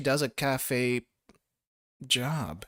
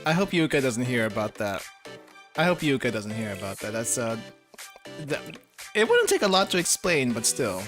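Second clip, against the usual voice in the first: deep male voice; 5 semitones lower than usual. Acted. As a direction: drop into a low calm man's voice. Voicing Sensei.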